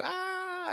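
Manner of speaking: high, soft comic wail; cartoonish "aaah" scream; mock falling scream. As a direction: imitate someone flung away, very high and small. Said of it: "And they go".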